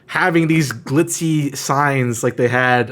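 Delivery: ordinary talk, nothing marked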